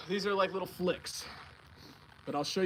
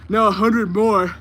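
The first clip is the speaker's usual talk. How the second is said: loud, slow joking dare; mock challenge; 3 semitones higher, 11 dB louder, slower than usual. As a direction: call it out like a coach's bluff. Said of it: "Yeah, no. Not doing that".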